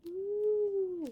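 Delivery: high, drawn-out ooh; a playful gasp of intrigue; mock surprise. Real, not acted. Reader's dramatic aside.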